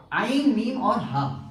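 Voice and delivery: slow letter-by-letter spelling of عین میم اور ہا. Shown as a demonstration. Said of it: "آپ کو لفظ بتاتا ہوں".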